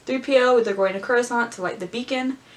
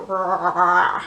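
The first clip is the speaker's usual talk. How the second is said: lightly voiced lizard roar; a half-hearted growl of a word; mock roar; 4 semitones lower, 4 dB louder than usual. Mild mock Bossk roar for viewers. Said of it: "I don't even know how he sounds".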